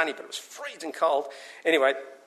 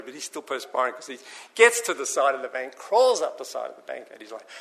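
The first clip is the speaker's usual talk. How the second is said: ordinary talk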